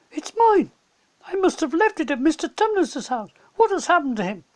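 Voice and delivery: put-on startled little girl; Lucy. A narrator's reading. Lucy recognizing her handkerchief, high and upset.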